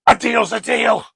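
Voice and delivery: loud, high royal declaration; high-pitched mock-regal call; proclaiming king. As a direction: announce it to the court as a hammy king. Acting the king's proclamation.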